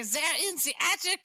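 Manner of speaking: very high-pitched mock German accent; cartoonish high German-accented voice. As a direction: squeal it in a hammed-up German accent. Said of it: "in a German accent".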